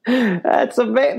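slow, high, laughing exclamation; laughing disbelief. Real, not acted. Reacting to John's violent pledge, slow laughing disbelief. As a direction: draw it out high through real laughter.

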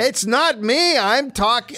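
high put-on voice, insisting; Socrates voice. Possessed husband denies it to his wife, high voice. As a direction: deny it in the high philosopher voice. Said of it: "it's Socrates".

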